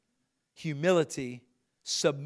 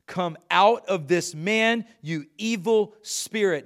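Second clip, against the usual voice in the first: commanding; 4 semitones higher, 5 dB louder, slower than usual. A narrator's reading. Jesus commanding the demon.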